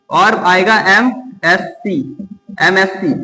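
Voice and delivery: एमएससी drawn out slowly as em-es-see, starting on the vowel e; slow clear pronunciation. Shown as a demonstration. Teacher shows students how to pronounce एमएससी, slowly. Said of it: "इसको प्रोनाउंसिएट करते हैं".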